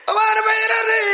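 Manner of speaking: high sobbing shout to the brothers; tearful sermon cry. Acted. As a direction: break into a weeping call. Crying out to congregation. (কান্নাজড়ানো উচ্চ আহ্বান)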